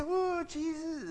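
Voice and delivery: mock pious high "Oh, Jesus"; drawn-out holy voice, mildly mocking; slow, lightly hammed-up piety; pious sigh. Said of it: "You can't say".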